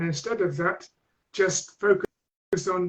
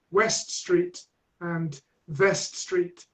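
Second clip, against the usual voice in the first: slow, careful "West Street" and "Vest Street"; slow street names; 3 dB quieter, much slower than usual. Shown as a demonstration. Teacher spelling out "West Street" and "Vest Street" slowly. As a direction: stretch out each street name clearly. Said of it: "have a game where".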